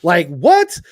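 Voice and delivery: high, loud, laughing "what the fuck"; astonished and amused; shocked disbelief. Retold or imitated. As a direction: retell the moment of shock, laughing. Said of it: "And I was like".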